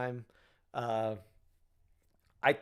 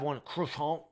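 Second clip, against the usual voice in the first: croissant in a put-on French accent; mock French pronunciation of croissant. People ordering a croissant, mock French accent. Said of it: "they'll put on this French accent".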